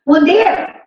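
sharp parental call. Acted. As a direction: raise your voice like a mother calling. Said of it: "Suara naik berapa persen?".

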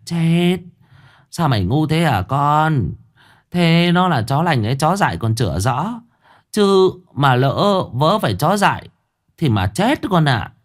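a mother waving off a foolish idea; mother scolding her son in alarm. Acted. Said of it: "xua tay".

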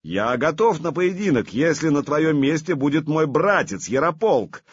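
high, loud booming reply; thundering voice. Acted. Vladimir answers the challenge, thundering voice. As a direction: thunder it back from the saddle. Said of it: "прогремел он".

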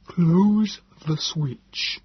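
very slow, high, alien Morlock voice; Nebogipfel, insistent. A narrator's reading. The Morlock telling the narrator to close the switch, very slow and alien. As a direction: draw each word out, inhuman and slow.

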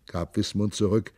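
ordinary delivery, nothing marked

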